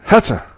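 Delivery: loud, high, full sneeze. Acted. The sneeze bursts out full, loud and high. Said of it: "Did you just sneeze?".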